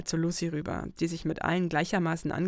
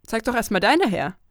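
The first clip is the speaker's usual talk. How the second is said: louder, higher, laughing comeback; playful retort; 5 semitones higher, 9 dB louder than usual. Acted. Narrator tells Lucy to show her own letters first, playful and loud. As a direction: tease right back.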